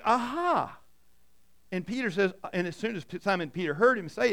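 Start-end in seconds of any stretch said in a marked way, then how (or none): 0.0-0.8 aha moment. Retold or imitated. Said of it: "it's kinda like".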